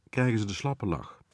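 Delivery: plain reading voice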